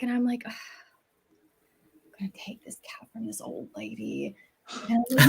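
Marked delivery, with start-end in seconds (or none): 0.5-4.4 whispered thought. Retold or imitated. Guilty inner thought.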